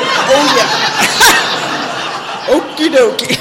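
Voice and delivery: laughing off the counselor's remark; laughing reply. Retold or imitated. Answering the counselor, laughing it off. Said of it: "And I said".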